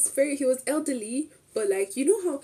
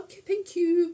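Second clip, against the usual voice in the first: cheery thanks; 9 semitones higher than usual. Retold or imitated.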